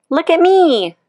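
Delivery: put-on a young beetle bragging to the world; proud little beetle. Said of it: "said the young click beetle".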